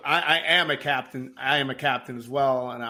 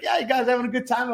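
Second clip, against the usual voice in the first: higher, bright customer-pleasing captain voice; fake cheery captain; 9 semitones higher than usual. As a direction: put on the happy host act for the tourists. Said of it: "I'd be like".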